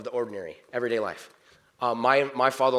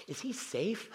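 high, soft, slow question through laughter; laughing question; 10 semitones higher, 6 dB quieter, slower than usual. Retold or imitated. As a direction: ask it slowly, chuckling. Quotes Narnia question while laughing.

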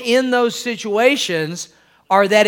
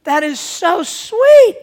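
high, louder gushing disbelief; shocked delighted wife; 13 semitones higher, 5 dB louder, slower than usual. Acted. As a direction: gush in an imitated wife's much higher voice. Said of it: "she's shocked. She can't believe it".

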